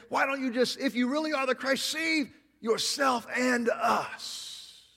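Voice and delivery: taunting thief. Retold or imitated.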